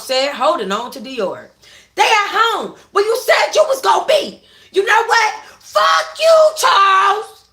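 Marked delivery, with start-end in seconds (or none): 1.9-7.4 very high, loud enraged scream. Acted. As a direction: scream it with everything. Akima screaming at Charles.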